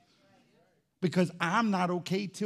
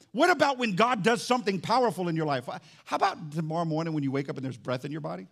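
ordinary talk

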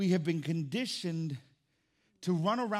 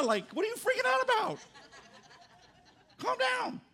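mock exasperation. Mocking brother's panic.

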